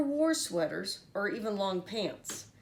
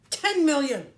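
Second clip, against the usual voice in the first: loud, higher shout of ten million; 5 semitones higher, 8 dB louder than usual. A narrator's reading. Archduke shouting his offer at Annabelle. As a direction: slam the offer down. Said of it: "he shouted".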